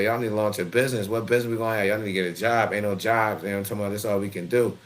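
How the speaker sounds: usual speaking voice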